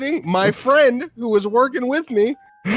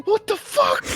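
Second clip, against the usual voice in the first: much higher incredulous cry; mock outrage; 13 semitones higher than usual. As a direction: play the robbed victim. Voicing scammed victim.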